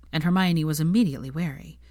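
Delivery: ordinary delivery, nothing marked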